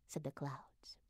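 ordinary delivery

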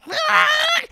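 high loud shriek; high scream. Acted. The imp shrieks in fury as he sinks.

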